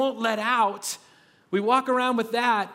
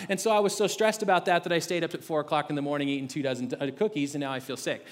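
oversharing ramble; 4 semitones lower than usual. Retold or imitated. Pastor answering a greeting with a rushed, sinking overshare. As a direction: pour it all out in one run. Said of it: "Is that what I'm supposed to say?".

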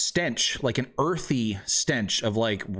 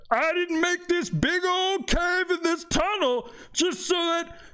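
much higher, sarcastic merchant voice; mock the customers from below; high-voiced shopkeeper snarking at lazy buyers; grumpy cave merchant. GM plays mushroom seller.

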